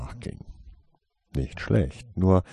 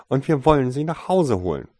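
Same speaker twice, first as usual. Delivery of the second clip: sharp insistence; 7 semitones higher, 9 dB louder than usual. A narrator's reading. Parent continuing sharp reply.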